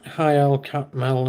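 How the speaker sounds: ordinary talk, nothing marked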